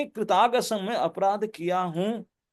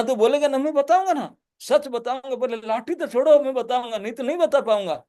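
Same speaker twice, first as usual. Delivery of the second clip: put-on pleading child; 6 semitones higher, 3 dB louder than usual. Child begs mother drop stick.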